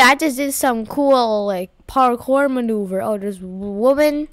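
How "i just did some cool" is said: ordinary delivery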